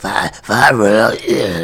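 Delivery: low, quiet just-woken mumble; sleepy puppet voice. Acted. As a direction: groan it out, half asleep.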